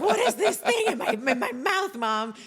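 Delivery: put-on squeaky, laughing little kid asking; amazed toddler voice through laughter; high giggling kid quote; laughing child voice.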